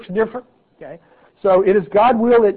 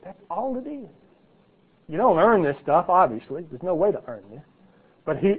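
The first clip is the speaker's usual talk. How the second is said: ordinary delivery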